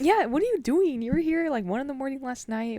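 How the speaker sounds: loud, high disbelieving outburst; what is wrong with this guy, said loud and high; exasperated. Real, not acted. Venting to a friend about a customer, loud and exasperated.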